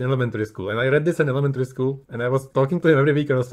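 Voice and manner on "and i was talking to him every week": ordinary talk, nothing marked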